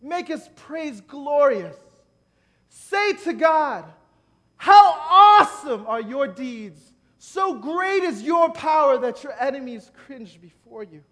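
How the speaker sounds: loud, high, slow proclamation; booming scripture reading; preaching at full force. Acted. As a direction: call the psalm out to the room. Opening scripture proclaimed loudly.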